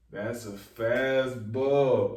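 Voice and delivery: FASTBALL stretched out long and hard; slow, emphatic repeat. Real, not acted. Vlogger letting a clever line sink in, slow and emphatic. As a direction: draw out "fastball", savoring each word.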